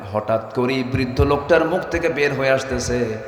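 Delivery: ordinary talk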